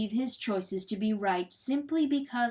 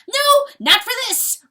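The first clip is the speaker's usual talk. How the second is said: very high, loud weasel roar; furious shouted outburst; 13 dB louder than usual. A narrator's reading. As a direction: roar the denial at full force. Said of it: "roared the weasel".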